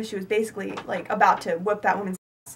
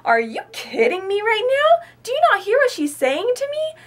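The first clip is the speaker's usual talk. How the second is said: loud, high incredulous protest; are you serious, pitched way up; high outraged disbelief; 10 semitones higher, 5 dB louder than usual. Retold or imitated. Teen to employee, high and loud in outraged disbelief.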